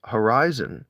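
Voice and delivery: ordinary delivery, nothing marked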